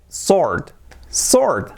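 "sword" with a silent w, like "sord"; correct sword. Shown as a demonstration. Teacher giving learners the correct form, silent-w sword. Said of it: "la pronunciación correcta es".

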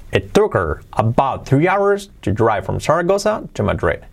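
put-on slow, read as if to your child or a theatre; careful English storytelling for an audience; expressive reading aloud. Shown as a demonstration. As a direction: read it out slowly with marked intonation. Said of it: "como si realmente estuvierais leyéndoselo a, pues, a vuestro hijo".

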